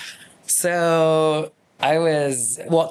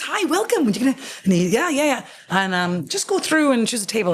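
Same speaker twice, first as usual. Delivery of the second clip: breezy waiter voice; 9 semitones higher than usual. Retold or imitated. Voicing the Italian concierge.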